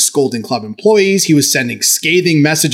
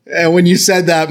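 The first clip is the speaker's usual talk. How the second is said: ordinary talk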